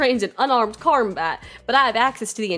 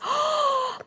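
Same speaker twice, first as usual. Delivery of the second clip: high, drawn-in gasp; shocked gasp. Acted. Shock over killing the snails.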